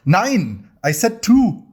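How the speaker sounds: high, mock-yelled Nein, I said two; hammed-up outburst at the waiter; mock scream. German guy in the joke yells at the waiter, hammed up. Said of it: "the German guy screams at him".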